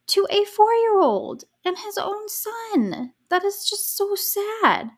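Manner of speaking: high, slow, outraged disbelief; outraged squeal. Real, not acted. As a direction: squeal it out, appalled at the father. Outraged reaction to father's cruelty.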